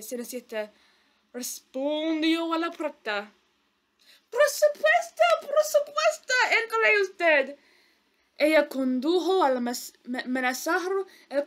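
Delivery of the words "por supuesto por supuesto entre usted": high, sugary fawning stepmother voice; wicked stepmother. Acted. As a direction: fawn over the visitor like a scheming stepmother. Stepmother greets messenger at door.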